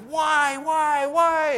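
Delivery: put-on high, loud, whining protest from a young son; child flipping out. Young son protesting to his father at bedtime, high and loud whining. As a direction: wail why like a child refusing bedtime. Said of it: "totally flips out and says".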